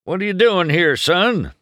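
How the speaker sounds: high, loud demand. A narrator's reading. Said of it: "Sheriff demanded".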